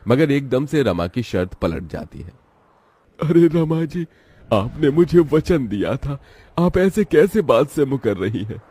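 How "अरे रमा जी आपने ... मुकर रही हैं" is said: higher, a touch of wounded protest; hurt father. Acted. Shankar confronts Rama breaking her word, hurt. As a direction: remind her of her promise, hurt.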